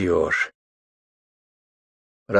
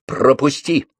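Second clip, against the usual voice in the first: sharp demand; 6 semitones higher, slower than usual. A narrator's reading. Lyolya blocks him, he demands passage.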